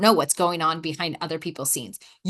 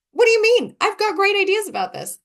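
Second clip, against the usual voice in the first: high, loud indignant protest; offended; 13 semitones higher, 6 dB louder than usual. Retold or imitated. Speaker reenacting being left out of running it, offended. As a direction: burst out in mock affront, pitched right up. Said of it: "a little offended".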